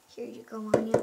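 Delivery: ordinary talk, nothing marked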